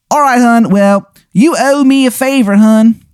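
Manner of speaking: very high-pitched cartoon character voice; Shithead Steve. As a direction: squeal it out in a put-on falsetto. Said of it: "And he goes".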